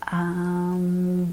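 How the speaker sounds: "Umbrella" stretched out sound by sound; really slow. Shown as a demonstration. Coach repeats the word for the listening child, really slow. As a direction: draw out each part of "Umbrella", low and even. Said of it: "read it really slowly".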